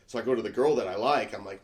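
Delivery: ordinary delivery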